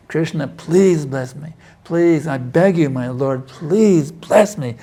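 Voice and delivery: slow begging that breaks high on the final please; pleading prayer. Pretend. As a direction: plead with Krishna as if drowning. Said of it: "You have to plead with Krishna".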